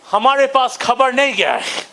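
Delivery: put-on fast, clipped British-accented Hindi; crisp British accent. Bengali comic mimicking his people's British accent. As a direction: rattle it off like a colonial gentleman. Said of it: "That is why we sound like this".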